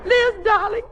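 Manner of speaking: high, weepy consoling; tearful pleading. Acted. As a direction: plead tenderly while close to tears. Mother catches daughter's crying.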